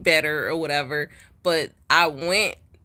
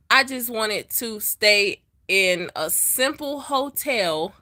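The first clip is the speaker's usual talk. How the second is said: normal talking voice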